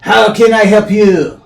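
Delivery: put-on French accent, shouted. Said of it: "Got, got a French accent".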